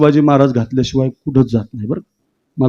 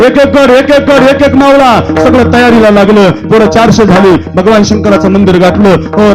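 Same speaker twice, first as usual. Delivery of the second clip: raised, driving preacher's voice; rousing kirtan storytelling; 7 semitones higher than usual. Acted. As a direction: build the muster of warriors with mounting fervour.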